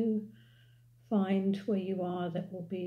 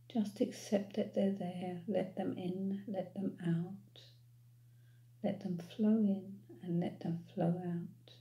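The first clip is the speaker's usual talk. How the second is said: calm, unhurried meditation voice with long pauses; guided meditation. Real, not acted. Guide leading listeners to follow their breath, calm meditation voice. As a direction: ease them gently into noticing the breath.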